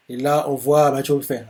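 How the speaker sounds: ordinary talk, nothing marked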